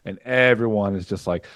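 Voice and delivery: ordinary talk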